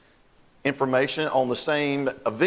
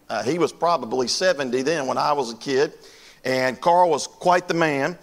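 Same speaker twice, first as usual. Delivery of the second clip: ordinary talk, nothing marked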